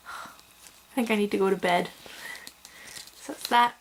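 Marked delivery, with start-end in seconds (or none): none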